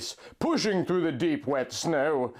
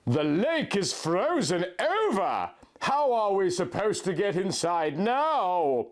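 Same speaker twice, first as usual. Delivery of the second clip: surprised; 6 semitones higher than usual. A narrator's reading. Jenna surprised at frozen lake.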